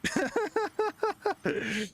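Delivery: sudden high-pitched laugh; high burst of laughter. Real, not acted. Laughs at own rage baiting.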